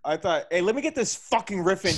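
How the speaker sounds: ordinary talk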